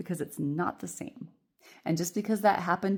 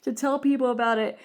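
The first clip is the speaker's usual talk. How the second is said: higher and louder gushing excitement; acted excitement, higher and louder; excited; 7 semitones higher, 7 dB louder than usual. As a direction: burst out with high, loud joy. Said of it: "I said".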